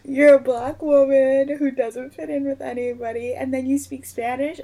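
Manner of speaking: loud, high, incredulous; loud high disbelief at piling labels; exasperated outburst. Real, not acted. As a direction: throw up your hands, voice up. Escalating rant about not fitting.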